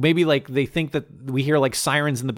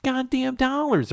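indignant; 11 semitones higher than usual. Acted. Defending the bet.

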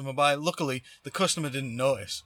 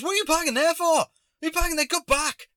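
high, loud mock yelling; rapid-fire irate stranger, hammed up; imitated angry demand; 5 dB louder, faster than usual. Stranger confronting driver over parking, imitated angry yell. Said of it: "Like that".

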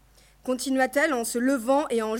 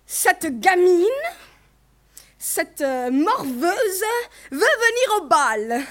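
slow, raised, contemptuous outrage; angry derision; 5 semitones higher, 5 dB louder, much slower than usual. A narrator's reading. Mother mocking the girl wanting the ball, angry derision. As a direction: draw the insults out with mocking anger. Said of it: "d'une voix enrouée de colère".

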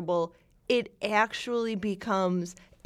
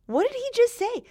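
baffled; 13 semitones higher, 4 dB louder, faster than usual. Retold or imitated. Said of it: "you'd be like".

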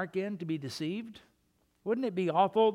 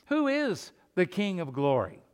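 ordinary talk

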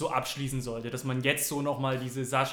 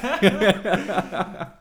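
laugh; 4 semitones higher, 8 dB louder than usual. Real, not acted. Laughing at co-host's jab.

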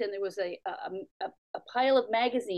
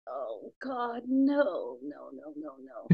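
dreading groan; 6 semitones lower, 3 dB quieter, much slower than usual. Retold or imitated. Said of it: "I'd be like".

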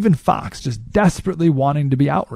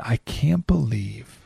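hushed, breathy scandalized whisper; mock gasp of disapproval; 2 semitones lower, 9 dB quieter than usual. Quoting a holier-than-thou panelist, softer, dropping to a whisper. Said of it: "some other person said".

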